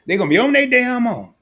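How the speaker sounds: rising into a loud, high gloat; mock gleeful shout. Dad gloating over ending child support, loud mock crow. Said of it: "I'm like".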